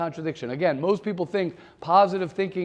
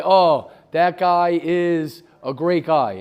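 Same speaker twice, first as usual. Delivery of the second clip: slow, louder mock praise; acted admiring thought; 7 dB louder, slower than usual. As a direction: weigh out the compliment loudly and slowly. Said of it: "You look at a guy and you say".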